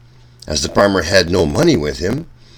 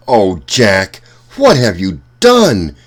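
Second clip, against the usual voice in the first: mother shouting at son, much louder; angry shout; 3 semitones higher, 7 dB louder, slower than usual. Acted. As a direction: scold him loudly in dismay. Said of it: "she shouted".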